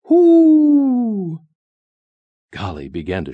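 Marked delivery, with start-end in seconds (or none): 0.1-1.4 big, long, high-pitched "whoo"; loud hoot. A narrator's reading. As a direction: boom out a spooky hoot. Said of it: "answered in a deep, loud voice".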